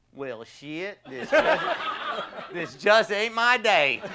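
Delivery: imitating a good old Southern boy, breaking into laughter high up; Southern patient's quote cracked up with laughter; laughing Southern boy. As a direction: laugh through the patient's resigned line. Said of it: "He's a good old Southern boy".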